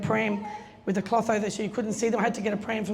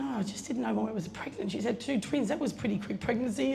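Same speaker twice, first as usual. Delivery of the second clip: retold thought, much softer, half to herself; the café owner wondering to herself, much softer; hushed inner thought; 7 dB quieter than usual. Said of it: "So she's thinking".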